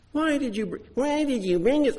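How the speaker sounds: high, nagging whine of the complaining people; whiny voice. Acted. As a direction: whine it like a spoiled child. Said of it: "I have to put my whiny voice on".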